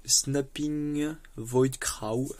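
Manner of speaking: very slow English card name in a French accent. Real, not acted. Player reading an English card name, French accent. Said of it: "J'adore mon accent".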